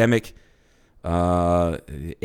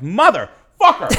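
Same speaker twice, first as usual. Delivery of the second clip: slow, higher, louder mock curse; frustrated curse; 10 semitones higher, 4 dB louder, slower than usual. Retelling his reaction to her booking, slow exasperated curse. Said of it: "I'm like".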